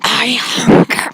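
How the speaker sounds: slow drawn-out creepy voice; mild menacing caller. Acted. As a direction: stretch it out eerily. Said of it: "I can't do a menacing voice".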